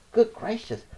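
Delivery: plain reading voice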